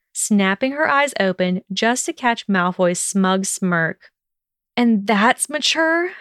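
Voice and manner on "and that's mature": higher, slow indignant question; a mild hiss, stretched out and rising. A narrator's reading. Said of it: "she hissed".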